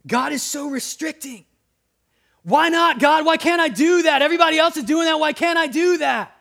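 high, loud whiny complaint; whining. Pretend. Believers whining at God over his rules, high and loud. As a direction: whine at God like a spoiled kid. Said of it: "we can whine at things and say".

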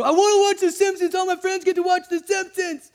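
put-on high, loud whining child voice; whiny kid. Child whining to parents about The Simpsons, high and loud. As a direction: whine like a six-year-old begging. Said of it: "throwing a hissy fit".

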